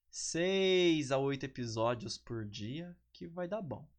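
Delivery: ordinary talk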